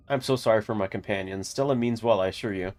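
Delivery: usual speaking voice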